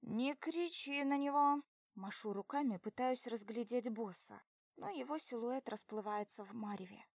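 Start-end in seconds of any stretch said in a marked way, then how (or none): 0.0-1.6 louder, higher protest; shouting. Acted. Drunk heroine shouts.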